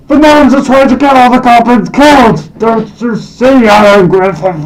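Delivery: wide-eyed, desperate to make Alan see; a paranoid goblin losing it over the conspiracy; frantic conspiracy rant. Acted. Ranting goblin conspiracy theorist.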